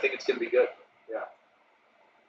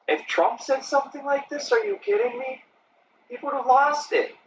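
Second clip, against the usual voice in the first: pitched way up, mock-outraged; worked-up rant; 8 semitones higher than usual. Real, not acted. Streamer imagines the uproar if Trump said it, pitched high in mock outrage. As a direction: build the outrage, voice climbing high.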